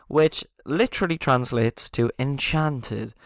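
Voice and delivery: ordinary talk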